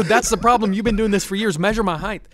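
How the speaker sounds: loud, high-pitched exasperated retort; exasperated outburst. Retold or imitated. Patient arguing with hospital staff, exasperated and loud. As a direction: raise your voice, insisting they listen.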